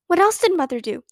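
high-pitched, eager question. A narrator's reading. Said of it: "Beezus asked eagerly".

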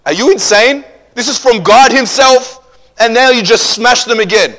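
high, loud incredulous shout; acted exasperated outburst; outraged scolding. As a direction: scold at full volume, drawing out key words. Acts outraged scolding over smashed tablets.

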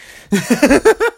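high, loud wheezing laugh; helpless laughing. Real, not acted. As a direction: crack up at your own joke. Cracks up at own joke.

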